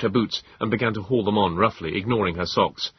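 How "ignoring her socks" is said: ordinary delivery, nothing marked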